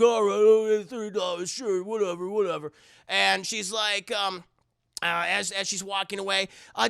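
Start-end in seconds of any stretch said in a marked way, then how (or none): 0.0-2.7 a loud, put-on grubby hobo voice, mostly tossed-off; lazy hobo. Hobo brushes off the woman paying him, in a loud put-on voice. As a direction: shrug it off like a sleazy drifter. Hobo answers her, retold.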